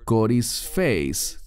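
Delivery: "corisfeis" said very slowly, syllable by syllable; slow spelled-out word. Shown as a demonstration. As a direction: lay out "corisfeis" slowly for the class. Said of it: "es la palabra".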